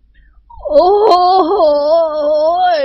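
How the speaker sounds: high, drawn-out mournful howl; ghostly howling wail. Acted. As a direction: wail like a dying man and a howling dog. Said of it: "แกล้งร้องครวญครางเสียงโหยหวน".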